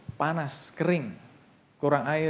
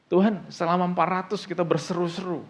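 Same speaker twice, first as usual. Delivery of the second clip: questioning God; 4 semitones higher, 4 dB louder than usual. Acted. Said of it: "bertanya-tanya sama Tuhan".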